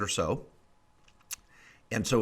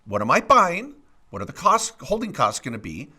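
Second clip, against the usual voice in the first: higher, louder pitch to the folks at home; rising presenter pitch; 6 semitones higher, 4 dB louder than usual. Acted. Host turns to the audience, pitching in a seminar voice.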